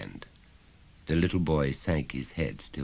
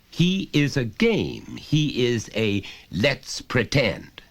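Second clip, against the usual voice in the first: slow, emphatic lecture; stern father, slow; 9 semitones higher, 5 dB louder, slower than usual. Acted. Father lecturing son, slow and stern. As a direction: spell it out slowly to the child.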